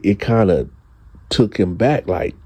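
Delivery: usual speaking voice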